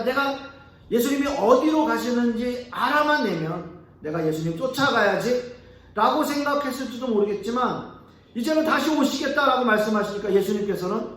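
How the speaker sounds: ordinary delivery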